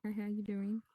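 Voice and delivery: soft, understated catchphrase greeting; signature greeting. Shown as a demonstration. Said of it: "Why you say it like".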